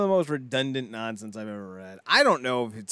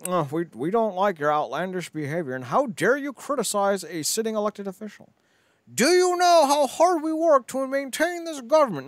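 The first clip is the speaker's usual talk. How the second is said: high-pitched mock indignation, building louder; offended conservative; 9 semitones higher, 3 dB louder than usual. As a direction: imitate a scandalized conservative, rising in outrage. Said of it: "the conservatives were like".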